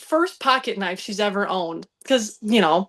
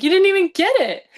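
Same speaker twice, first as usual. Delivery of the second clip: high and loud disbelief; little sister mocking the missed throw, high and loud; incredulous kid taunt; 10 semitones higher, 6 dB louder, faster than usual. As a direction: call out the miss in shock. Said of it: "I'm like".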